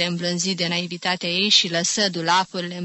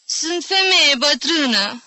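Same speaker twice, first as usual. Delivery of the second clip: slow, higher, wounded; offended; 8 semitones higher, 4 dB louder than usual. A narrator's reading. Old aunt offended by her nephew, slow and wounded. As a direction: let the hurt sink in slowly. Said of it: "adăugă ea jignită".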